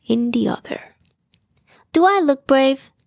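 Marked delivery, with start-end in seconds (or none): 1.9-2.9 higher and louder, eager to please; hopeful question. Acted. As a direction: ask it bright and hopeful. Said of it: "he asked".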